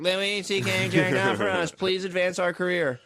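mock publicity plea. Mocking retold plea to newspaper.

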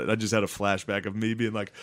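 ordinary talk

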